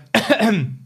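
real throat clearing after swallowing wrong; choking on a drink. Real, not acted. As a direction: clear the throat after gulping mulled wine. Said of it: "da hab ich verschluckt am Glühwein".

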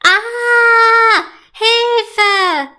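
loud, high cry for help; shrieked, panicky wail; panicked scream. A narrator's reading. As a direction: scream in terror, drawn out. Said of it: "schreit Marian panisch".

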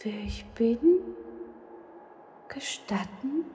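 a mysterious kind spirit introducing himself; hooting ghost. Acted. Ghost introducing himself to the little witch in a high soft whisper. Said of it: "schuhute der mondlichtglitzernde Geist Silberhaar".

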